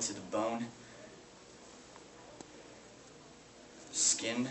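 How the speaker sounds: low, hushed mourning with long pauses; grieving. Acted. Brother over his sister's casket, hushed grieving. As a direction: speak very softly, halting over each detail. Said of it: "weeps over his sister's casket, grieving".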